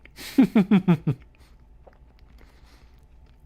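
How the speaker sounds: loud cackling laugh; loud laughter. Real, not acted. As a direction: burst into laughter. Laughs at the game.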